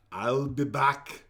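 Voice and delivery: Arnold impression. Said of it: "Question fifty".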